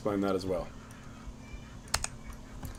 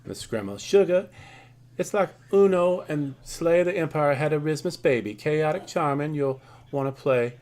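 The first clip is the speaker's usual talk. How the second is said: put-on southern accent; 4 semitones higher, 4 dB louder than usual. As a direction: gush it like a Southern grandma calling you sugar. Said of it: "why I had a southern accent there".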